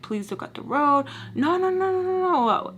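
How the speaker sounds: high-pitched pleading, imitating panic; retold panicked yelling. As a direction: act out a frantic plea without full volume. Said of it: "I'm, like, yelling at him".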